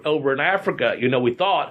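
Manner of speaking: usual speaking voice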